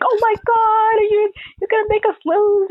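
high, loud panicked shout; panicked yelling. Retold or imitated. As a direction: yell it at your partner in a panic. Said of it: "letting off the F-bombs".

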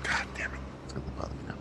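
whispered annoyance, soft; frustrated whisper. Real, not acted. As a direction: hiss it out under your breath. Frustrated whisper, can't remember.

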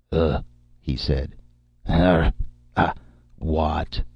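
halting grunts between pauses; struggling to speak. A narrator's reading. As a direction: grope for words in broken syllables. Ape-man tests human speech.

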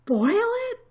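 shocked intake, then horrified question; gasp, then blurt it in alarm. A narrator's reading. Elephant hearing the boiling threat, gasping. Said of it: "gasped Horton".

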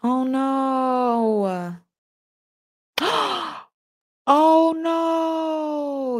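high, breaking oh no after a gasp; real dismay at sad news; hurt, disbelieving oh no; stunned grief. Real, not acted. Grieving news of [name].

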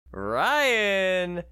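loud, high drawn-out name; announcer call. Acted. Announcing next player.

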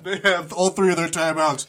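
loud call-out. Real, not acted. Calling out over the game.

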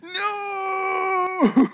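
long, very high "no"; hammed-up despairing cry; anguished wail. Boaz hears the rival will redeem Ruth, anguished wail. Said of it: "I just imagine Boaz going".

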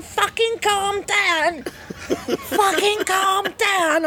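high, very slow, breaking into laughter; mock outrage, laughing. Prisoner mocking officers, slow high laughing outrage. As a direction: drag it out in hammed-up fury.

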